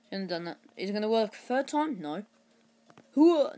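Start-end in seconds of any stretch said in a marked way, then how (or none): 3.2-3.6 high, loud battle cry; loud gung-ho soldier. Pretend. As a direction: charge in like a soldier.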